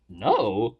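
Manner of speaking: gasp, then a hushed offended question; imitated affronted disbelief; mock offended. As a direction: act taken aback, low after a gasp. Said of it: "Like, offended".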